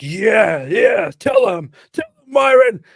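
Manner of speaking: loud, high hype-man shouts; imitating a rowdy supporter; mock cheering on. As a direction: egg him on with drawn-out, hammed-up yells. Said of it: "expecting people to go like".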